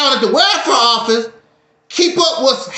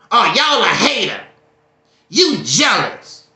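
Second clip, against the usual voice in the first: mock hater voice; 5 semitones lower than usual. Imitating critics brushing off the truth. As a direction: sneer it like a dismissive critic.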